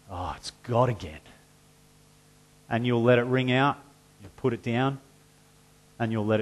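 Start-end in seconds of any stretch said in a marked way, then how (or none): 0.1-1.2 lower, softer, bored mock sigh: "Oh, it's God again."; weary brush-off. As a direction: shrug off the caller in a dull voice. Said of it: "and you'll go".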